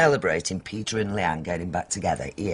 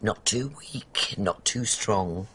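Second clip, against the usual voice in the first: drawn out; slower than usual. Acted. Savouring her tea boast.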